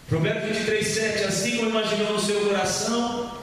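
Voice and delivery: usual speaking voice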